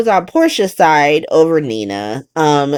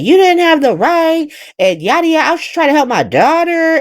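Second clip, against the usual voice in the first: mock flipping out; 7 semitones higher, faster than usual. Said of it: "Nina starts flipping out".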